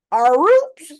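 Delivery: high, loud echo of the question; loud incredulous repeat; mock disbelief. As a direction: throw the words back, startled. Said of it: "mock disbelief".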